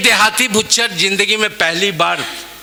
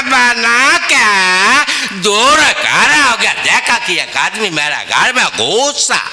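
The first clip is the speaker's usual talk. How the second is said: South Indian Hindi, raised and lively; Madrasi accent in Hindi; 5 semitones higher than usual. Acted. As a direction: tell it like a Madrasi babu. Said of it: "मैं दोनों का अंदाज आपके बीच पेश कर रहा हूँ".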